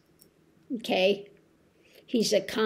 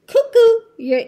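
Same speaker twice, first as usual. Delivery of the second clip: high, loud cuckoo-clock call; 11 semitones higher, 8 dB louder than usual. Pretend. Mocking him as a cuckoo clock.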